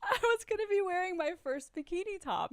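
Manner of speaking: very high voice, fresh from laughing; amused squeal. Real, not acted. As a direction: squeal the confession, barely over the giggles. Gleeful confession after long laugh.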